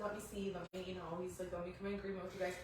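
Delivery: a lightly voiced reassuring husband; calm husband. Husband calmly looks at the bump, soft and steady.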